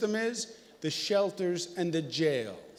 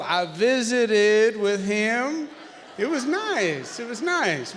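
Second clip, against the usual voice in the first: slow, drawn-out southern drawl, laid back; mock Arkansas drawl, in no hurry; 4 semitones higher, 4 dB louder, slower than usual. Shown as a demonstration. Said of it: "I do it with a little southern drawl".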